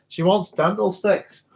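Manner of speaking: slow called-out line aimed across the room; called across the room to the wife, half-shouted. Real, not acted. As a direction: call it out slowly to someone in another room. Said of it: "I shouldn't have shouted that into the microphone".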